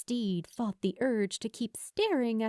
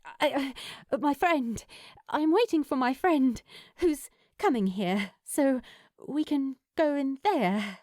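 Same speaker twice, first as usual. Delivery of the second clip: higher, louder, flustered stammer; nervous woman stammering to her crush; stumbling over words; 4 semitones higher, 4 dB louder than usual. Acted. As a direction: trip over the words, caught off guard by the stranger. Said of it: "Steed stumbled over her words a bit".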